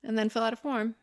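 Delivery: lightly put-on survey recruiter; mall recruiter. Mall recruiter invites a shopper to a movie, lightly imitated. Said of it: "and they said".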